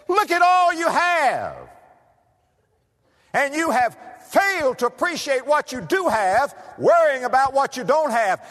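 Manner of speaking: mock stern preacher chiding; preaching rebuke. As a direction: press the point home to the couple. Pastor's counsel, preaching force.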